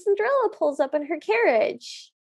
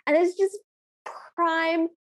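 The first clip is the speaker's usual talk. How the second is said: ordinary talk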